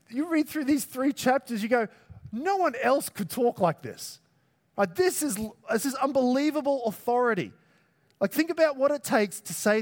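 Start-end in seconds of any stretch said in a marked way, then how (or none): 2.3-7.5 high-pitched, emphatic amazement; awed disbelief, voice lifted; amazed reader. Acted. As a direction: react as if stunned by what you read. Acting out an amazed reader's reaction.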